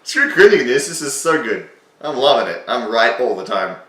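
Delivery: loud, high, hammed-up self-praise; mock smugness. Mocking his younger self, loud and smug. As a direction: gloat like a show-off, tongue in cheek.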